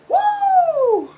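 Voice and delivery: very high, short whoop; playful high-pitched whoop; falsetto woo. Pretend.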